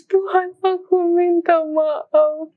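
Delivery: high, drawn-out mock-tearful plea; retold tearful prayer. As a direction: re-enact begging God's forgiveness, stretched and high. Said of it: "Baru tuh aku bener-bener nangis".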